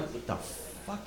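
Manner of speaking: barely voiced, baffled mock whisper; stunned whisper. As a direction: whisper it in disbelief. Baffled reaction to yowling cat.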